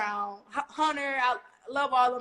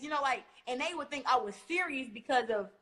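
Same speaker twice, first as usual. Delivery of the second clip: normal talking voice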